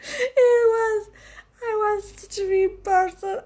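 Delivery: high, giggly talk; laughing while talking. Real, not acted. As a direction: laugh through the words. Laughing through the confession.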